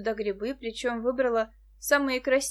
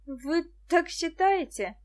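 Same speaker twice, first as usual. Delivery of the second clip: slow, higher, bashful question; embarrassed; 7 semitones higher, 3 dB louder, slower than usual. A narrator's reading. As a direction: ask it shyly, drawing it out. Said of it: "засмущалась вдруг Муся". (смущённо)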